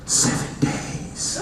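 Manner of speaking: slow, hushed phone threat; creepy whisper. Acted. Dad prank-calling his kid on a blocked ID, creepy whisper. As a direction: draw it out in an eerie whisper.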